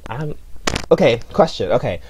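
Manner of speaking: normal talking voice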